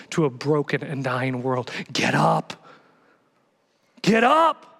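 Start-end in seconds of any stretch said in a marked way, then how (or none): 1.9-4.7 from firm to loud, high "be the church"; building rally cry. Acted. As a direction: climax of the sermon, rousing. Closing rally to congregation.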